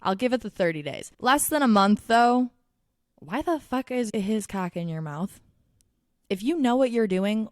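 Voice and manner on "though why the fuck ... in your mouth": ordinary talk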